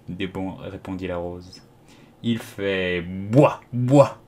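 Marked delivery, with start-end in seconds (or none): 2.2-4.2 imitated tree bark, first one drawn out; drawn-out mock barking; daisy crying out. A narrator's reading. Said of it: "s'écria une pâquerette".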